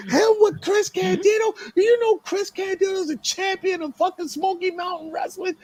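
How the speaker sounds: outraged, very high-pitched yelling; Dennis Carluzzo. Retold or imitated. Promoter refusing an unknown kid his main event, shrieking angrily. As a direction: imitate a man losing it in disbelief. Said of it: "He's going nuts".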